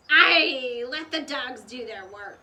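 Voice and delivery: loud gruff pirate captain giving orders; pirate growl. A narrator's reading. Captain Bones orders his men, growling loudly. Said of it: "growled Captain Bones".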